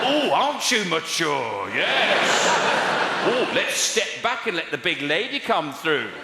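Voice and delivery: exaggerated mock admiration, high and loud; heckler put-down. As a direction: jeer with heavy, drawn-out sarcasm. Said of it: "treating the kids like hecklers".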